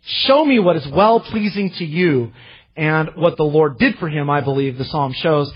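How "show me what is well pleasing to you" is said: urgent request. Acted. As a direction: beg for guidance with fervour. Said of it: "you hear his request".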